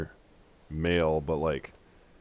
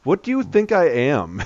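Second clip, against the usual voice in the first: loud, higher exasperated retort; 8 semitones higher, 6 dB louder than usual. Retold or imitated. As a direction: throw it back in disbelief. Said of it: "It's like".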